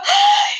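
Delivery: high-pitched cry of "ay"; exclaimed cry. Retold or imitated. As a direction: let out a sudden high "ay" of revelation. Cries out at the revelation.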